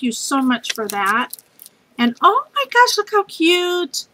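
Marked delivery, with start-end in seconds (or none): 2.0-4.1 high delighted squeal over cute stamps; thrilled squeal at a gift; excited squeal. Real, not acted. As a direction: gush with delight, pitched way up.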